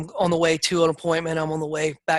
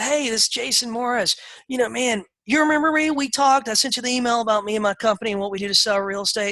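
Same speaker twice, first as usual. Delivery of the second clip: high, eager friendly pitch to the seller; bright and eager, selling himself; upbeat sales call; 6 semitones higher than usual. Retold or imitated. Agent pitching a homeowner by phone, eager and upbeat. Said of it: "and I said".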